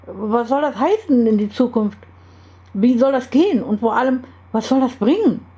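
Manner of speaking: acted bewildered protest, pitch jumping up; agitated questioning. A narrator's reading. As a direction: fire off exasperated questions. Baffled Carlos demands explanations in story.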